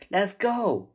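loud, high shout; urgent call. A narrator's reading. Girl calling her brother to flee, urgent shout. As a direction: shout to get them moving. Sister calls brother to leave.

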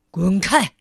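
low, loud, rude brush-off; rude snap. Acted. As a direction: bark it like a grumpy rude man. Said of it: "傲慢先生粗鲁地说". (粗鲁低沉地吼)

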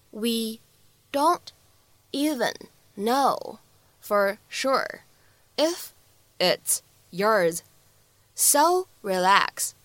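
slow, each word separated by a pause; isolated, carefully spaced words. Shown as a demonstration. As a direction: say it word by word for learners. Teacher models line word by word.

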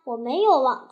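usual speaking voice

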